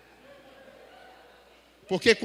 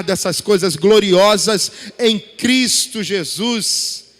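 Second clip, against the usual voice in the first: loud, slow, drawn-out proclamation to the church; full-force preaching; 3 semitones lower, 9 dB louder, slower than usual. Acted. Pastor preaching grace to his congregation, loud and slow. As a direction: thunder it out over the congregation, slowly.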